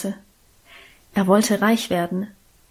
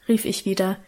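ordinary delivery, nothing marked

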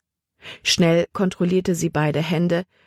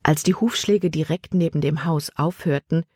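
usual speaking voice

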